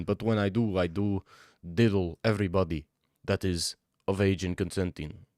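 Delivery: put-on slow, heavy Russian accent; Russian gangster introducing himself, deliberate pace; Ivar, Russian gangster. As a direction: play a Russian gangster, laying the accent on thick. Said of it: "That's my impression of, uh, Ivar".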